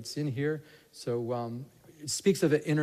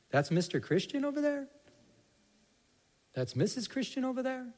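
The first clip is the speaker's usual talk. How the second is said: mocking onlookers; 8 semitones higher, 5 dB quieter than usual.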